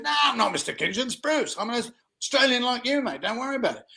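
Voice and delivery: high, quick, breezy reassurance; breezy Aussie boss. Retold or imitated. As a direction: brush off the formality like a cheerful boss. Boss insists on first names.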